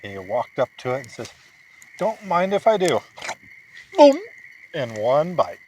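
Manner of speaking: put-on telling a bedtime story to a sleepy child; bedtime story narration. A narrator's reading. Dad reading a bedtime story to his son, animated storytelling.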